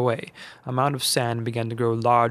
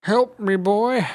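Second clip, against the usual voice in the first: put-on higher, loud old man call for help; struggling Uncle Seamus; 9 semitones higher, 5 dB louder, slower than usual. A narrator's reading. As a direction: ask for a hand. Uncle Seamus can't stand.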